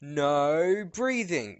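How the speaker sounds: slow, drawn-out Michael Rosen voice; Michael Rosen impression. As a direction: stretch it out like Michael Rosen doing the bit. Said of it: "if you've seen Michael Rosen".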